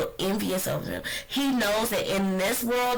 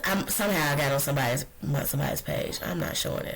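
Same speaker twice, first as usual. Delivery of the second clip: ordinary talk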